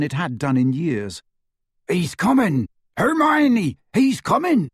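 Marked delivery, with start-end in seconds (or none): 1.9-4.7 high, frantic yelled warning. A narrator's reading. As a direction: yell the warning in panic. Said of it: "As he yelled".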